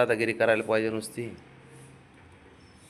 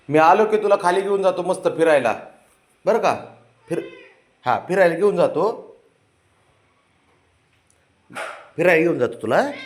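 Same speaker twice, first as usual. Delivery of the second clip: coaxing pet talk; 7 semitones higher, 3 dB louder than usual. Real, not acted. Owner coaxes his sulking dog not to sulk, high sing-song baby talk. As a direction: coax a sulking pet to cheer up.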